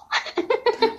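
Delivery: real high giggle, soft. Real, not acted. Host giggling at the caller's qaf.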